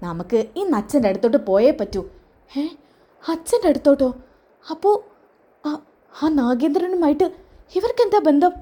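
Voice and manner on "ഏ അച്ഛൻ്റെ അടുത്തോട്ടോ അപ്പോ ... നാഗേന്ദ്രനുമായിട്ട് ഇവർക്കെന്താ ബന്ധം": shocked inner question. Acted. Jalaja shocked at overheard talk.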